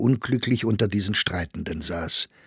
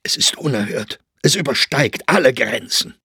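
tense indignation breaking out louder; suppressed outrage; 3 semitones higher, 4 dB louder than usual. A narrator's reading. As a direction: start held back, then press harder. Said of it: "mit gedämpfter Stimme". (unterdrückte Empörung, die lauter wird)

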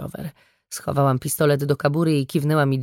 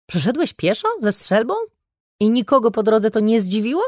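higher-pitched, mildly exasperated questions; irritated; 6 semitones higher than usual. A narrator's reading. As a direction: question him with a touch of irritation. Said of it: "zapytałam zirytowana". (z lekką irytacją)

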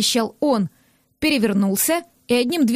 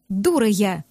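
ordinary delivery, nothing marked

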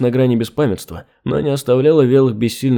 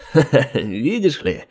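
slow, sneering chuckle into words; mocking laugh; slower than usual. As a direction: laugh at the helpless child. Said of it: "издевательски хохотнул".

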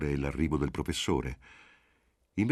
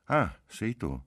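higher, mildly surprised "ah"; involuntary exclamation; 6 semitones higher, slower than usual. A narrator's reading. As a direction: let it slip out, between disappointment and relief. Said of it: "non poté fare a meno di esclamare".

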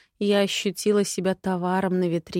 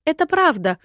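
much higher, timid little squeak; 9 semitones higher, 7 dB louder than usual. A narrator's reading. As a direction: squeak out the protest. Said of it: "пискнула одна из сестёр".

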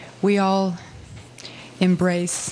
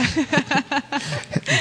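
laugh; 6 semitones higher than usual. Real, not acted. Laughing at joke about impression.

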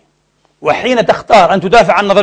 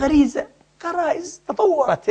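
mock evolutionist; 9 semitones higher, 8 dB quieter than usual. Mocking the evolutionists' reply.

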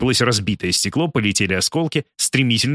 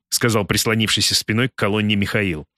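usual speaking voice

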